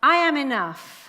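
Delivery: loud, lifted and certain; loud firm affirmation. Shown as a demonstration. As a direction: proclaim it with conviction. Affirmation call and repeat.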